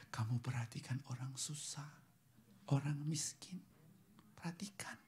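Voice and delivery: very soft, nearly whispered plea; hushed solemn appeal. Acted. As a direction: let each phrase sink in quietly. God's gentle charge, quoted to congregation. (imbauan lirih, hampir berbisik)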